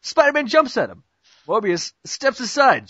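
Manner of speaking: ordinary delivery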